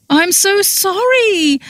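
very high, drawn-out wailed apology; wailing. A narrator's reading. Friend confessing to mortified woman, wailing. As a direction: wail it, pleading and slow. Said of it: "she wailed".

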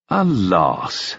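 smooth, drawn-out echo; silken. A narrator's reading. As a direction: repeat it silkily. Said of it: "repeated in a silken voice".

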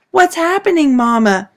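put-on high, loud frightened scream of a child; startled child screaming for Mama. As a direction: cry out in terror in the night. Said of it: "Anna screamed".

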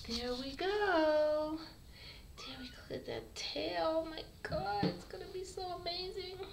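high, sweet voice for the kitten; high cooing. Real, not acted. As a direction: coo over his tail.

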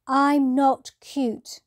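high, loud and slow, insisting; a small owl insisting hard; indignant protest. Acted.

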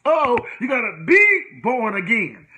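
normal talking voice

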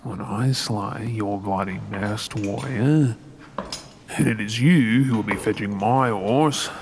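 ordinary delivery

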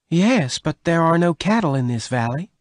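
high-pitched character voice, mildly puzzled; Bess, puzzled. Acted. Bess doubts Venters's offer of beef, puzzled.

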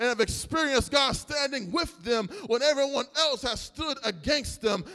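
loud preaching over the music, rising to a cry; full-force preaching. Acted. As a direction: preach it out, the crowd with you. Preacher building to a climax.

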